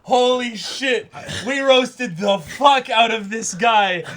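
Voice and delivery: high, loud and slow with laughter; gleeful shouting of triumph; ecstatic yelling. Real, not acted. Proud of roasting the villain, yelling in laughing triumph. Overjoyed over being proven right.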